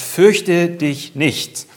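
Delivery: slow, loud, weighty declaration; solemn proclamation. Acted. Preacher voicing God to the congregation, slow and solemn. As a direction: proclaim it slowly like God speaking.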